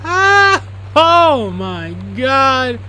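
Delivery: high, loud, drawn-out shock; astonished outburst. Real, not acted. Player seeing a thousand-dollar Laserdisc player, real astonished cry. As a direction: cry out and stretch every word.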